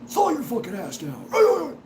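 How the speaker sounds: high, drawn-out put-on bark; hammed-up angry command voice; mock drill-style yell. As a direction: play at chewing someone out, slowly. Acting out a quick-tempered yell.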